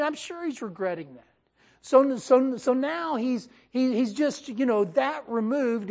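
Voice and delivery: ordinary delivery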